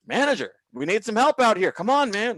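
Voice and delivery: pleading holler across a kitchen; exasperated call for help. Retold or imitated. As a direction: call out for backup, fed up. Calling manager for help.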